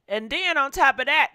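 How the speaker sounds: ordinary talk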